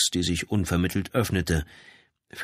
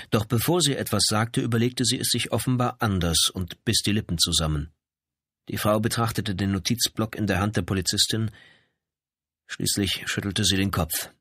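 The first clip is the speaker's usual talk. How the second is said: ordinary delivery, nothing marked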